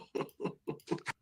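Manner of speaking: soft, breathy chuckle; low chuckle. Real, not acted. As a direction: laugh quietly to yourself. Laughs after signing off.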